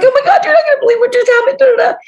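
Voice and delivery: very high, fast rush of news; breathless excitement. Retold or imitated. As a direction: blurt out the wild news in one breath. Excited call to friend.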